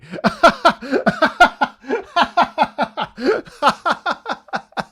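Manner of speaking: long, loud, high cackling laughter; uproarious laughing fit. Real, not acted. Streamer tickled by mother-in-law's lesson, uproarious laughter. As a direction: lose it completely, laughing hard. Mother-in-law taught the swear word.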